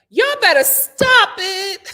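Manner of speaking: high, loud, slow wail; half-laughing cry; whiny loud plea. Real, not acted. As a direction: beg the critters to stop. Scolding critters.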